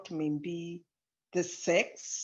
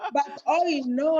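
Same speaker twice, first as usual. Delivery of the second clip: higher and louder, through real laughter; laughing; 6 semitones higher, 5 dB louder than usual. Real, not acted. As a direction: say it while still laughing. Repeats phrase while laughing.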